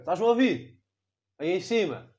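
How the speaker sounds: loud, high call upward. Pretend. Boy yelling up at God, calling. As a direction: shout up to the sky.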